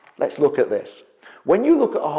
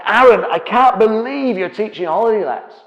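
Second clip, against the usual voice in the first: loud, higher mock-furious outburst; furious customer; 8 semitones higher, 9 dB louder than usual. Retelling an angry client at a seminar, loud mock fury. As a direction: act out the angry client confronting you. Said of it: "he is furious".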